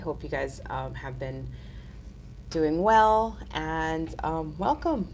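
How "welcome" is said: usual speaking voice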